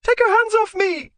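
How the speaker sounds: very high, loud shriek. Acted. Wife shrieking at husband grabbing her. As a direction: shriek to be let go. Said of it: "Another shriek".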